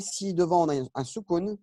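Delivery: ordinary talk, nothing marked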